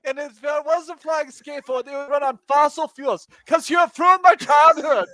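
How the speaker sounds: high, loud, laughing mock tirade; a high, shouted childish outrage; hammed-up Greta rant shouting and laughing. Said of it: "the Swedish girl says".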